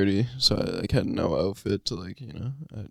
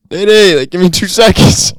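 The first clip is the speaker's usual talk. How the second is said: loud high call through the door; 11 semitones higher, 14 dB louder than usual. Retold or imitated. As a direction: shout up to someone in another room. Calling out to the cleaner.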